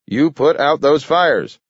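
high, loud angry order; screaming. A narrator's reading. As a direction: keep screaming it. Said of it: "screamed the mayor".

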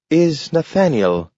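high voice, each word pronounced carefully; a dog proudly giving his name; talking dog, careful. A narrator's reading. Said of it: "pronounced carefully".

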